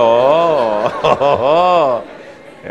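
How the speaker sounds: long drawn-out "oh"s of mock astonishment; amazed. Pastor reacting to an atheist's confession, amazed. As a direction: draw out each oh in wonder.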